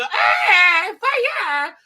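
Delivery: shrill, very high mock-girly cry; loud hammed-up squeal like a girl; girlish scream. Retelling the film scene, a loud mock-girly scream. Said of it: "Like a girl".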